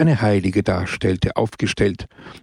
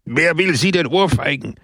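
exasperated, higher-pitched outburst; losing patience; 6 semitones higher than usual. A narrator's reading. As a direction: burst out at the timid priest. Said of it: "Pepone verlor gänzlich die Geduld".

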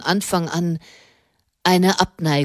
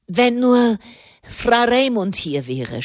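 mild groan; 6 semitones higher than usual. Acted. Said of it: "stöhnte er".

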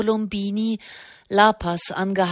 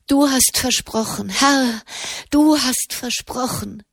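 urgent prayer, building higher and more insistent; bestürmendes Flehen; 3 semitones higher than usual. A narrator's reading. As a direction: press God stubbornly to keep the promise. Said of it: "begann Gott im Himmel zu bestürmen". (eindringlich bestürmendes Gebet, zunehmend höher)

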